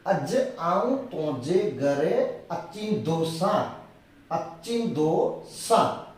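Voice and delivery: slow and soft, stretched out for learners; slow soft dictation. Shown as a demonstration. Teacher repeating the common Kutchi form slowly. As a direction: drop your voice and give it slowly. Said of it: "mostly यही है".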